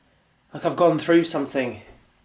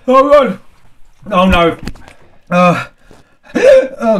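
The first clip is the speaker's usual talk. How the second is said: loud, higher pained groaning; 7 semitones higher, 8 dB louder than usual. Real, not acted. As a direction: moan through burning pain. Suffering burning sauce, hiccups.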